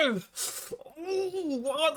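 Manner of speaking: normal talking voice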